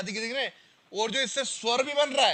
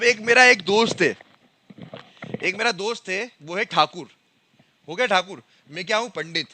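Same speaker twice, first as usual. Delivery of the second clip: loud emphatic example; 10 dB louder than usual. Shown as a demonstration. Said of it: "teacher's example with friends".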